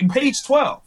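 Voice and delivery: high-pitched, obvious-answer tone; retold earnest insistence; insistent reader. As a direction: imitate a friend sure he's right.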